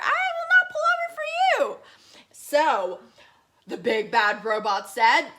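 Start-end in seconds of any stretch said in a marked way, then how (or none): 0.0-1.8 high, fast whisper of refusal; tiny alien whispering no; whispered defiance. Acted. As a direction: hiss it out defiantly. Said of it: "the little alien said".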